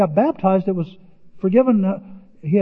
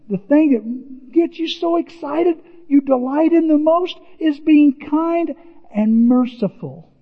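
much higher, slow and glowing; delighted; 9 semitones higher than usual. Real, not acted. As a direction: savor the joy of mercy. Delight in God's kindness.

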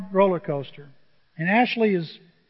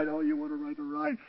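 soothing; 8 semitones higher, 7 dB quieter than usual. Retold or imitated. Soothing the crying girl.

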